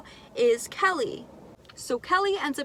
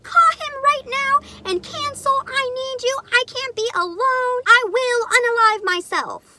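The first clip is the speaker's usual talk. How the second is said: high, loud, slow frantic pleading; hysterical demand; 12 semitones higher, 8 dB louder than usual. Retold or imitated. Best friend demanding she cancel her date, frantic high shout. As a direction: shriek it like a best friend losing it. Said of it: "She goes off on me".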